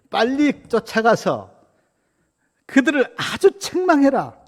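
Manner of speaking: high, loud acted scolding; stern rebuke. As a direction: order a reprimand, loud and high. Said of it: "그러면서 책망하라 그래요".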